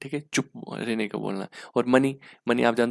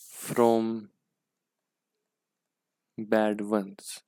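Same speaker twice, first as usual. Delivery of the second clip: slow dictation; much slower than usual. Shown as a demonstration. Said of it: "dictating the meaning word by word".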